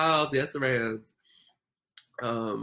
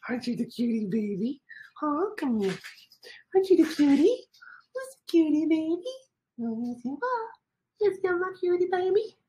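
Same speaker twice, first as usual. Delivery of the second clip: very high-pitched cooing; squeaky, adoring pet voice. Real, not acted. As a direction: coo it like talking to a puppy. Cooing to her dog.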